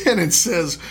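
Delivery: ordinary delivery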